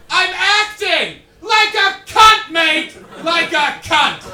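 very loud, high, stretched-out holler; imitated indignant bellow, drawn out; yelling back. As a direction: shout the retort across a street. Actor yelling back across the street.

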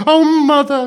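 put-on loud, very high childish wail; sobbing child. A narrator's reading. Child cries to her mother, sobbing loudly. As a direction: wail it out like a distraught little girl. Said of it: "sobbed Blue Eyes".

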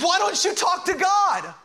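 high, shouted demand; pleading captain's cry; exasperated captain. Acted. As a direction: challenge the sleeper urgently, at the top of the range. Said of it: "the captain comes out and goes".

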